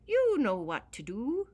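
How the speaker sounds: high, loud little-man voice; a grateful leprechaun, pitched high. Acted. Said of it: "said the leprechaun".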